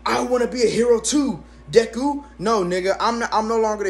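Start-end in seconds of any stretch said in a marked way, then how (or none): 0.0-2.2 lightly voiced anime hero, a touch higher; playful nod to My Hero Academia; anime hero quote. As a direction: quote the show's line in a hint of a hero voice. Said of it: "not My Hero Academia".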